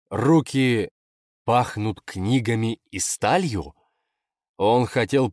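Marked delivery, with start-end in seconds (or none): 0.1-3.7 appalled, drawn-out questioning echo; disbelieving quote of an embarrassing phrase; incredulous. Acted. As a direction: repeat it slowly as an outraged question. Embarrassed man repeats the description incredulously.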